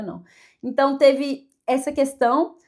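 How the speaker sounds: usual speaking voice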